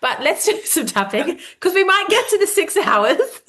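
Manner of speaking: joking to the guest about the long chat; playful mock exclaim. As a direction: tease her with a bright burst. Joking self-quote to guest.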